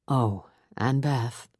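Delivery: low, slow pastor voice; gentle reverend. A narrator's reading. Reverend stopping Beth at the door, gentle. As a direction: call her back gently.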